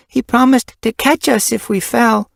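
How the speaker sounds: put-on young kit voice.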